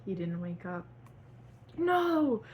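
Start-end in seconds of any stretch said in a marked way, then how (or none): 1.8-2.4 high, loud anguished no; hammed-up sobbing wail; sobbed no. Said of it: "Frank sobbed".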